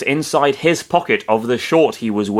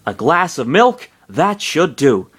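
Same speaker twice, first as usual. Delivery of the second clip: higher, lilting character line; story character; 5 semitones higher, slower than usual. A narrator's reading. Narrator voicing Pitt spotting the milk, lightly acted. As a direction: lightly voice a character pleased with a find.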